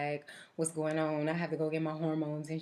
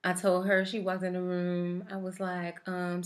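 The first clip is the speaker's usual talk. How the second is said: ordinary talk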